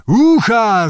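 high, loud whoop; joyful howl. A narrator's reading. Drunken Cossacks answering the rousing call, joyful howl. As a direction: howl it gladly like a cheering crowd. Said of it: "zawyli radośnie kozacy".

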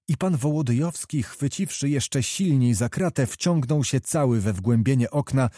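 usual speaking voice

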